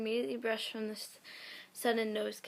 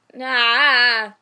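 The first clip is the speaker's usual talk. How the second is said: loud drawn-out "Nah" in a cracking yell; a sudden yelled protest against the story; shouted nah; 16 dB louder than usual. Real, not acted. Reading a cringe fanfic to viewers, yelling out a refusal. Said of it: "loud cry-shout reaction".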